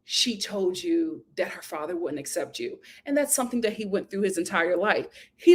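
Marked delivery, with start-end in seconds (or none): 0.0-2.8 soft, dawning understanding; quiet realization. Retold or imitated. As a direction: drop the voice as it clicks. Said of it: "And then I was like".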